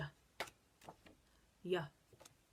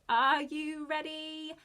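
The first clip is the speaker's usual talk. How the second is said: booming slow heroic call; slow, loud superhero hype-up; 6 semitones higher, 9 dB louder, slower than usual. Shown as a demonstration. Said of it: "shout it in your best superhero voice".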